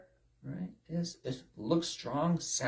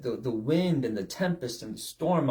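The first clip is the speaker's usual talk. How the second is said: normal talking voice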